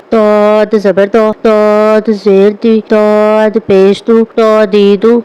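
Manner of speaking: slow, heavy full-mouthed ظ in تو دی دو. Shown as a demonstration. Teacher drilling pupils on the heavy ظ, slow. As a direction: thicken the ظ of دی, slowly. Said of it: "اس کو بھی پر اور موٹا پڑھیں گے".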